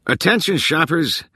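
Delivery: high-pitched public announcement; joking announcement yelled to a crowd; megaphone yell. A narrator's reading. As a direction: call it out like a store announcer. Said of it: "yelled into a megaphone".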